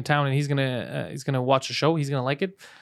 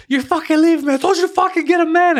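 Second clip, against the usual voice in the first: very high, loud furious mother voice; screaming mom; 12 semitones higher, 4 dB louder than usual. Pretend. Mom yelling at her son.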